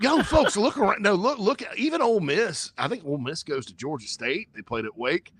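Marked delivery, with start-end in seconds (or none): 0.0-2.7 excited. Real, not acted. Rising to an excited outburst.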